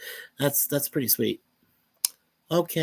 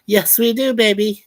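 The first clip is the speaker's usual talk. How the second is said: excited; 5 semitones higher, 6 dB louder than usual. Real, not acted. Replying happily to wife's chat message.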